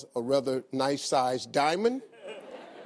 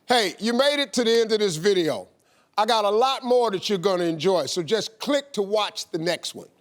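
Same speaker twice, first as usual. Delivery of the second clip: louder, upbeat promo read; youtube outro; 5 semitones higher, 4 dB louder than usual. Acted. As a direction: hype the viewer to click and subscribe. Video outro pitch.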